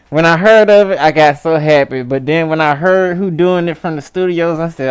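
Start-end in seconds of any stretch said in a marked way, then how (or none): none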